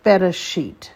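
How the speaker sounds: Bereshit said apart and careful, falling at the end; teacher pronouncing Bereshit; Hebrew model word. Shown as a demonstration. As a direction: say Bereshit clearly for the class to repeat. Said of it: "The first one is Bereshit".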